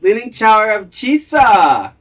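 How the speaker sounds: loud, slow, high-pitched movie-character shout; drawn-out, hollered catchphrase impression; Pauly Shore. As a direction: belt it out high, stretching the words. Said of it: "That's Pauly Shore".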